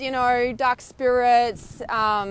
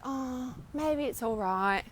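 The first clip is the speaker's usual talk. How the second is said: softer, slow, hesitant giving in; softer, slow, talking yourself out of leaving; doubtful backing down; 8 dB quieter, slower than usual. Retold or imitated. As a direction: let the resolve drain out. Giving in, second-guessing.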